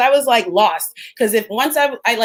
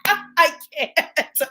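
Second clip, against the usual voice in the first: high-pitched disbelief breaking into laughter; incredulous laughing; 9 semitones higher, much slower than usual. Real, not acted. Reacting to an absurd racism accusation, laughing in disbelief. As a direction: laugh helplessly through the words.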